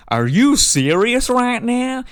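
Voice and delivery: high, loud, slow incredulous gasp of a question; stunned American asking if it's real; disbelief. Retold or imitated. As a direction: drag it out in total disbelief. Americans gobsmacked by TV license.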